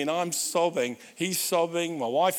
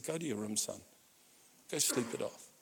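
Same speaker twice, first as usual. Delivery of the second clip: very soft, low instruction; quiet shock; 5 semitones lower, 10 dB quieter than usual. Retold or imitated. Shocked father to his drunk son, hushed and low. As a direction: lower and soften it, no rebuke. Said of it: "I just was shocked".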